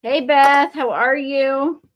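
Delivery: ordinary talk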